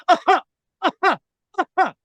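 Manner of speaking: high, imitated staccato laugh; put-on laugh in short high bursts; Andrew Dice Clay. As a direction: mimic a lone heckling cackle from the back. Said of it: "It's Andrew going".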